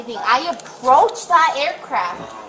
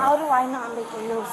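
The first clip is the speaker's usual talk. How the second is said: slurred speech; 4 semitones lower, 7 dB quieter than usual. Real, not acted. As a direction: slur it like you're intoxicated. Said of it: "You're slurring your words".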